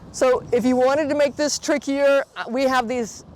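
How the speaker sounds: usual speaking voice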